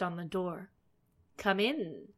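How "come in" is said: ordinary delivery, nothing marked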